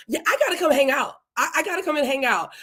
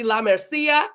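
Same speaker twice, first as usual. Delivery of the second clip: name said with a Spanish accent; slower than usual. Shown as a demonstration. Host playfully trying a viewer's name, in a Spanish accent. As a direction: put a light Spanish accent on it. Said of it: "put a Spanish accent on everything".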